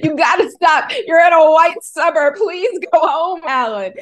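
loud, high, laughing plea to stop; urgent plea. Retold or imitated. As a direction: beg him to go home, half laughing. Urging brother not to get arrested.

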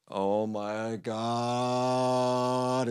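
long sustained awe; awestruck. A narrator's reading. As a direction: stretch every word out in amazement. Amazed reaction to sprouting plants.

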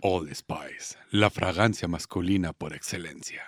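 sexy ad voice. Shown as a demonstration. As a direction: breathe the slogan low and slow. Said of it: "vas a decir con tu voz sexy".

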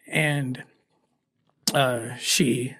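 fighting back tears mid-story; choking up. Real, not acted. Telling the host about the girl, choked up. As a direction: voice catching, trailing off quietly. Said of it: "why I'm getting so emotional".

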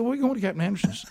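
high, quick comeback; retold eager retort. Retells his eager reply to wife.

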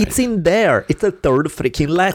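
high-pitched indignant insistence; exasperated protest. Real, not acted. Italian speaker arguing that the L exists, exasperated. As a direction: insist in frustration, pitch jumping up.

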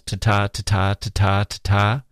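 ta-ta with the second ta stressed each time; four even ta-ta beats, second syllable hit; iambic beat. Shown as a demonstration. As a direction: tap out the iambic rhythm, weak then strong. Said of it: "four pairs of unstressed and stressed syllables".

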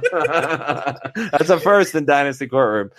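words spilling through laughter; slow, laughing through it. Real, not acted. Laughing at own ruling.

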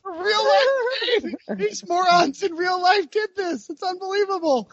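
very high-pitched disbelieving cry; incredulous outburst. Real, not acted. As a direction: exclaim it way up high, stretching the words. Exclaiming over botched trade story.

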